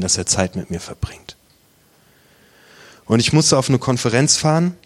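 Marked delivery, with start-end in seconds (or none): 0.0-1.4 breathy whispered confession, voice dropping away. Real, not acted. A man ashamed before God, whispering.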